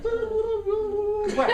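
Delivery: tiny, very high squeal; other inner voice. Acted. The drowned-out other voice pipes up, comic high squeal. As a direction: squeak it out as a silly mock voice. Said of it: "then the other voice is like".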